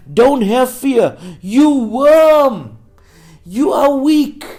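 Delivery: high, loud, declamatory pulpit voice; full-force preaching. Real, not acted. Preacher urges named listeners not to fear, full-force preaching.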